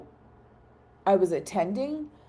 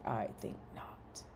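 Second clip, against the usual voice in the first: low unvoiced mock-haughty whisper; whispered retort; 9 semitones lower, 16 dB quieter than usual. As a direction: breathe it out with mock disdain. Teasing co-host Mike.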